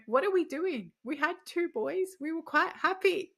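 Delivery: high, loud retold protest at a husband; mock exasperated outcry. Labouring friend rounds on her husband, loud exasperated outcry. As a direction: cry out in mock disbelief, loud and high-pitched.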